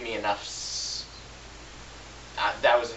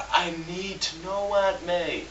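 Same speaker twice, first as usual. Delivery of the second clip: higher, slow, bad Andrew Garfield; an earnest but bad Garfield imitation; Andrew Garfield impression; 6 semitones higher, slower than usual. Reviewer quotes Peter's tearful line to Aunt May, badly imitated. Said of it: "I can't do an Andrew Garfield impression".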